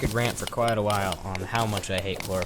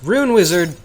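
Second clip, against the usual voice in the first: louder, higher call at the boss; excited shout; 7 semitones higher, 10 dB louder than usual. Real, not acted. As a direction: yell the name as it appears. Boss appears suddenly.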